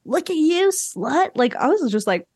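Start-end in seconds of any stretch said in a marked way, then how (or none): 0.0-1.3 a hint of a higher mocking jeer; sneering taunt. Retelling the boy's jab at Kristen, light mock sneer. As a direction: sneer it lightly, looking her up and down. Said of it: "he comes back with".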